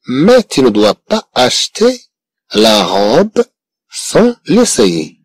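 very slow, word by word; slow model reading. Shown as a demonstration. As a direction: spell it out slowly for learners. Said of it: "teacher models slow French sentence". (قراءة بطيئة جدا كلمة كلمة)

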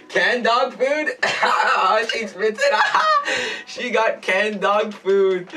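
high, laughing squeal; laughing through words. Real, not acted. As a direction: laugh it out in delight. Sister spits out dog food.

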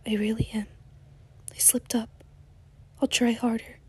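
soft pleading whisper. Acted. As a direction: whisper the apology into his back. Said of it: "he whispered".

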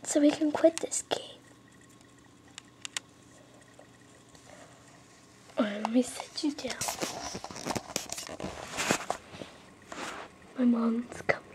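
hushed, hurried whisper; urgent whisper. Real, not acted. Kid hides the cheat as mom returns, hushed whisper. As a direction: keep it secret, mom's coming.

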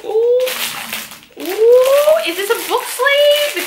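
high, eager squeal; thrilled ooh; excited ooh. Real, not acted. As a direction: gasp with real delight. Opening a new item.

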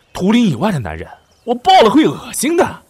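high, loud overacted declaration; hammed-up vow. Prince overselling his devotion to the guard, loud and high. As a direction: swear it theatrically, far too loud.

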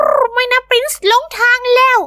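high, slow squawky parrot voice; squawking parrot. Acted. The lost parrot Prince calls out in a high squawk. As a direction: screech it out like a frightened pet parrot. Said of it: "เพราะว่าเสียงของเจ้าปรินซ์".

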